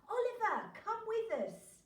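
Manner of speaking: high, loud call from far off. Acted. Parents calling from the sea.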